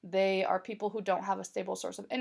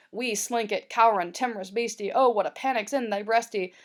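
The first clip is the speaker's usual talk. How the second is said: put-on a bit louder, in old Scots dialect with rolled Scots vowels; Scots original read aloud; 5 dB louder than usual. Shown as a demonstration. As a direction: read 'cowering, timorous beastie' as Robert Burns's Scots original. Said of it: "It's just kind of fun to, um, talk like that".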